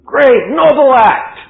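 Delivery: slow, loud, every word weighed; emphatic praise. A narrator's reading. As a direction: lean on each word with admiration. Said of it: "preacher's emphatic praise".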